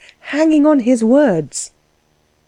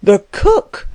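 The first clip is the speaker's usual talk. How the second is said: higher, galvanized exclamation; indignant cry; 4 semitones higher than usual. A narrator's reading. Klutz galvanized by the word cook, indignant cry. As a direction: cry it out in affront. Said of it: "cried Klutz, galvanized by the word".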